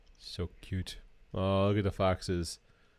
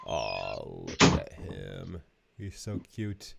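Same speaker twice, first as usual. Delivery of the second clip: cooing; much slower than usual. Real, not acted. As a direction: coo at the picture like at a puppy. Cooing at wolf pup photo.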